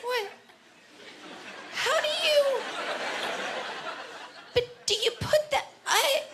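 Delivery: high, bewildered squeaky voice; confused squeal. Retold or imitated. Baffled shopper squeaking at strange sex toys.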